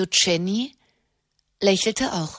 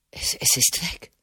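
awed whisper; 8 semitones lower, 6 dB quieter than usual. Acted. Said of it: "sagte er".

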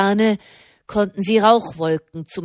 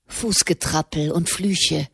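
ordinary delivery, nothing marked

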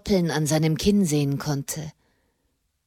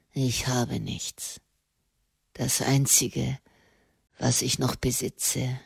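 mock her wearily from the depths; bitter condemned prisoner; 8 semitones lower, 5 dB quieter than usual. A narrator's reading. Condemned man who thinks he dreams the nun, weary scorn.

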